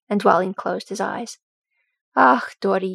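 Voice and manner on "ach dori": ordinary delivery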